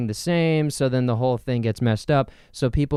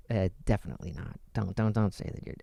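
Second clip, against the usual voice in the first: muttering; 6 semitones lower, 9 dB quieter, faster than usual. Real, not acted. Self-deprecating aside.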